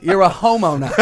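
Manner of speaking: put-on Boston accent. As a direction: punch homeowner in a thick Boston accent. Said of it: "Again, with the accent".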